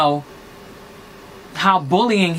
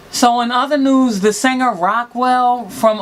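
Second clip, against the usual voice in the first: news reader; 4 semitones higher, slower than usual. Acted. Announcing next gossip story.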